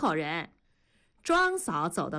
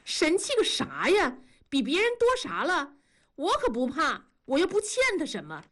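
high-pitched resentful outburst; high, cutting, indignant; sharp, piercing voice; 8 semitones higher than usual. A narrator's reading. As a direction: spit it out shrilly, full of spite. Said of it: "声音尖利地说".

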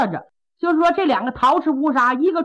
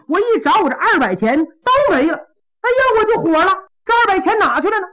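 higher and louder, fast and flustered at the end; rising anger; 6 semitones higher, 3 dB louder than usual. Acted. Mutton seller tells magistrate of his vanished money, rising anger. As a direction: burst out in alarm over the lost money.

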